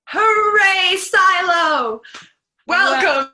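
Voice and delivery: loud happy cheer. Acted. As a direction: shout it out like a cheering crowd. Children cheering penguins.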